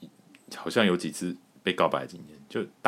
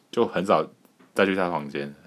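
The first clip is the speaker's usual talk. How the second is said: ordinary talk, nothing marked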